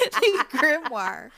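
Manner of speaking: laughing slow repeat. Shown as a demonstration. Repeating the word she fumbled, slow and laughing. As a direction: drag out "The Grimoire" through a giggle, amused at own slip. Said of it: "to go look for the Gwim".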